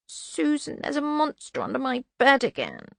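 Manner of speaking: put-on high little child's voice; plaintive child, high-pitched; small child. A narrator's reading. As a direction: pipe up high like a child at bedtime. Child Twyla calls her governess.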